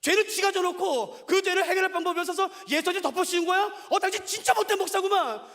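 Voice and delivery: imagined listener sneering at the pastor, loud scorn; scornful accusation. Retold or imitated. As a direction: scold the pastor with contempt. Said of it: "여러분이 그러시겠죠".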